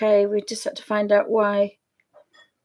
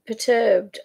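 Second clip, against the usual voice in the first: usual speaking voice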